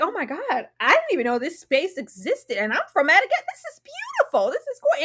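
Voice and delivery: high, breathless gushing over the view; dazzled girlfriend gushing; gushing in awe. Retold or imitated. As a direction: swoon at the beauty. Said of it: "Trish was like".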